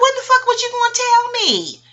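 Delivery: very high, loud indignant yell; outraged. Retold or imitated. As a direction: let him have it, betrayed. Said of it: "I'm like".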